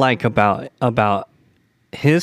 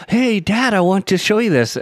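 put-on a kid's voice, pitched higher; eager child; 9 semitones higher than usual. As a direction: play the excited little kid tugging at dad. Child voiced asking dad.

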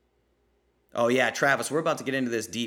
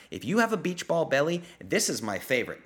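usual speaking voice

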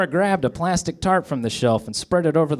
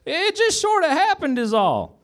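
very high, squeaky excuse; Barney voice. A narrator's reading. Barney explaining himself to Goober, high squeaky voice. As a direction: excuse yourself in a squeaky deputy voice. Barney continues after stammering.

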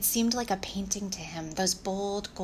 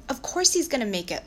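higher, louder, firm assurance; insistent; 5 semitones higher, 5 dB louder than usual. Acted. Louis defending the weak donkey's chances, insistent. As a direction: insist on it, pitch raised.

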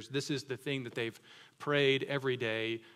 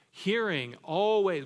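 emphatic, slow; 6 semitones higher, 6 dB louder, slower than usual. Real, not acted. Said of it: "preaching emphasis".

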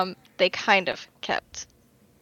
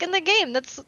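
higher-pitched, eager explanation; retold fan explaining, pitched up; 7 semitones higher than usual. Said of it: "Yeah, I was like".

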